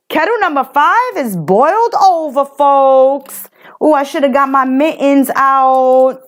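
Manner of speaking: bubbling over with playful excitement; excited. Real, not acted. Reader reacts after intense reading.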